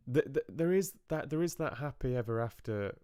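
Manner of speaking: usual speaking voice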